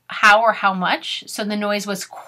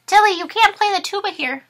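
much higher, quick scolding teacher voice; 10 semitones higher than usual. Acted. Storybook teacher telling Tilly to stop playing, higher and quick. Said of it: "Her teacher says".

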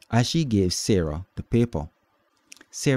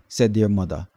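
plain reading voice

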